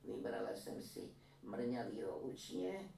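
lightly voiced old woman recounting; granny telling how they made it. A narrator's reading. Old woman telling her story. (lehce naznačená vyprávějící stařenka)

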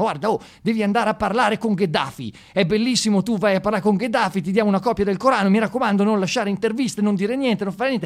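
high, loud excited pitch of the agency caller. Retold or imitated. Agency calling the comic with an exciting gig, high and loud. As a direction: sell the job like a thrilled agent. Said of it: "ti chiamano una volta e ti dicono".

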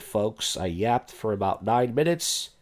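ordinary talk

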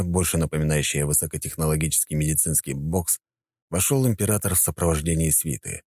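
ordinary delivery

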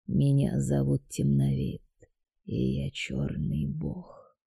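quiet sinister deity introducing himself; dark god voice. A narrator's reading.

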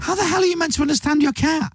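high-pitched, baffled outburst; comic exasperation. Acted. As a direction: squeal in mock despair. Exasperated rant about cats.

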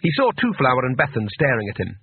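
usual speaking voice

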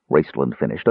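ordinary delivery

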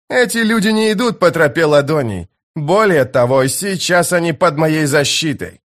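high, firm proclamation; commanding Jhag warrior. Acted. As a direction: warn the foe off those you protect. Jhag declares the travellers protected.

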